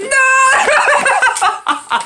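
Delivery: high wail in mid-laugh; imitated scream. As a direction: cry out between bursts of laughter. Imitated scream amid laughing fit.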